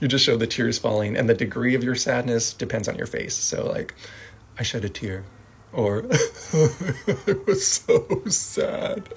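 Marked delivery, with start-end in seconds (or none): 4.6-9.0 mock sad through laughter. Shown as a demonstration. Acting out sadness.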